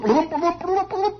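high, bubbling hoofbeat noises; imitated galloping. Storyteller imitating nobles galloping to the castle, high bloops. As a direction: burble like cartoon horses galloping away. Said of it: "they gallop off to the castle".

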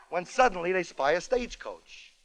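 ordinary delivery

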